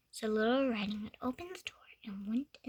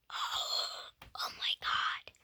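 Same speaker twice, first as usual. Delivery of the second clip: whispered surprise; 4 dB quieter, slower than usual. Real, not acted. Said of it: "reader reacts to the picture".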